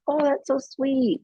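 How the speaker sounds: high, slow, tender coo; touched cooing. Acted. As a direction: melt a little, as if charmed by a child. Acting a touched parent's reaction.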